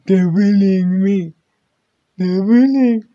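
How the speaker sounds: high, loud mock whimper; fake crying. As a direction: whine like a crybaby. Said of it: "you're worried about online bullying".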